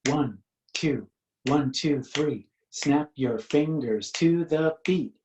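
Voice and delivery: usual speaking voice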